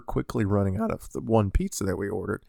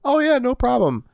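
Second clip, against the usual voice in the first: higher, easygoing retold clerk; imitated agreeable cashier; 12 semitones higher than usual. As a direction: lightly imitate an obliging kid at the till. Voices the agreeable kid at register.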